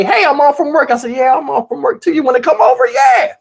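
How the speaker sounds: ordinary talk, nothing marked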